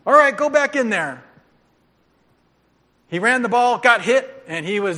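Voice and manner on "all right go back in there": higher, louder order to the player; coach's call. Retold or imitated. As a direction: send the boy back in. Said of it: "I guess he is okay".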